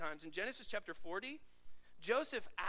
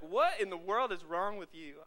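louder incredulous exclamation; mock exclaim; 7 dB louder than usual. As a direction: call out the sick coworker. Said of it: "you're like".

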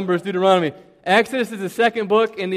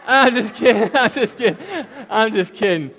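laughing through the words, louder and higher; 4 semitones higher, 6 dB louder than usual. Real, not acted. Speaker takes back a joke on the audience, laughing.

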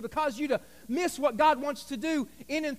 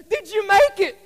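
high, louder anxious question; eager family; 7 semitones higher, 8 dB louder than usual. Retold or imitated. Pastor voicing the family asking the contestant. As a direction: ask it all hopeful. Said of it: "they say".

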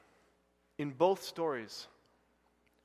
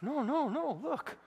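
defensive believer; 11 semitones higher, 10 dB louder than usual. Pretend. Preacher mocks Christians insisting their faith lives, defensive. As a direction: protest too much that you believe. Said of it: "that's the way we are with our faith".

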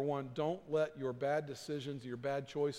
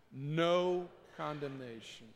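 with passion; slower than usual. Shown as a demonstration. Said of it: "With passion".

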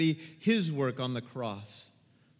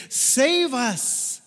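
crying out; 8 semitones higher, 6 dB louder than usual. Shown as a demonstration. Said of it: "It's a cry for help".

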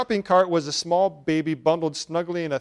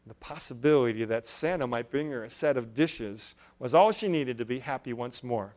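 ordinary delivery, nothing marked

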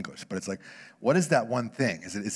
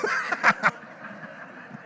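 real laugh. Real, not acted. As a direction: laugh at your own joke. Punchline laugh.

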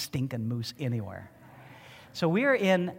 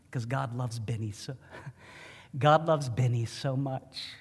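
ordinary talk, nothing marked

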